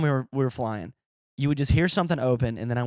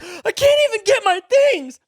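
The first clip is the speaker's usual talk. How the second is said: whiny big woman; 8 dB louder than usual. Retold or imitated. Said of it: "She's like".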